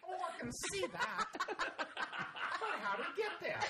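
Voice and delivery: hammed-up Scottish voice, very high; high, laughing mock-Scottish voice; attempted Scottish accent. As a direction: push a bad Scottish accent into a comic squeal. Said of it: "Can you do a Scottish accent".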